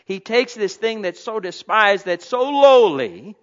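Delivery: loud, driving sermon; preaching at full force. Acted. Pastor preaching to the congregation at full force. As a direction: build and hammer each line.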